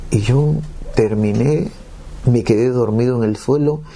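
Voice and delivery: ordinary talk, nothing marked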